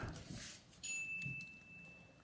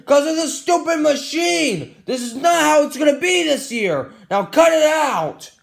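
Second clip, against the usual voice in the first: higher, hammed-up angry rant; mad rant; 6 semitones higher than usual. Ranting at the stupid machine, hammed-up anger. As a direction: rant in a raised pitch, indignantly. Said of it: "Oh, I'm mad".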